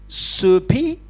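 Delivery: soupy with a questioning rise, lightly mocking; mock pidgin soupy. Mocking a delegate he doubts speaks English, soupy asked mildly. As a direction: tease the word soupy upward like a slow learner. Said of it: "He says to the Chinese delegate".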